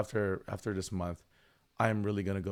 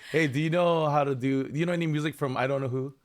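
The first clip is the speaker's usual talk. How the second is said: mock eager asker; 6 semitones higher, 5 dB louder than usual. Mimicking a chat request.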